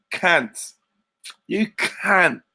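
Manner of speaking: hammed-up Cockney swearing, vowel stretched; Cockney accent. Shown as a demonstration. Host showing off a voice to listeners, Cockney insult. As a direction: spit the insult in broad Cockney. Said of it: "fucking Cockney voice".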